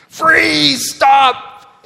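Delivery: very high, loud panicked shout; frantic scream. Retold or imitated. Mom shrieking at kids holding skunks. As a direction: scream it like a mom losing control. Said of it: "she reacted again".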